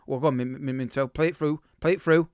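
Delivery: usual speaking voice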